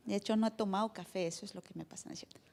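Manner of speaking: whispered joke about coffee; joking aside. Real, not acted. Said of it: "Ya me solté". (broma susurrada)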